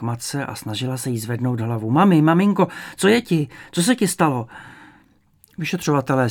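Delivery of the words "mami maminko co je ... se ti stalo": high, loud anxious calling; alarmed child. A narrator's reading. Daughter trying to rouse her fainted mother, alarmed.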